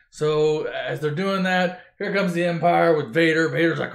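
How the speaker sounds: ordinary talk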